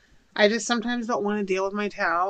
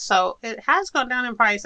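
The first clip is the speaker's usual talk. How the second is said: usual speaking voice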